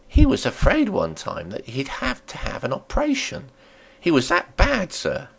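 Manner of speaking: a landlady warming to her story; landlady telling a tale. Acted.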